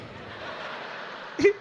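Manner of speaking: high, breathy wheeze of laughter. Real, not acted. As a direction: crack up at your own act. Laughs at his own act.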